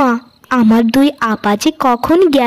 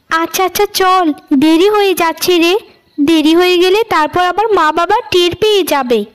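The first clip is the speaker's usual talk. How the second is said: put-on a lightly higher, sugary older-sister voice; scheming elder sister; 7 semitones higher than usual. Elder sister coaxing Payel out at night, lightly higher and sugary. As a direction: sweet-talk the little sister into a trap.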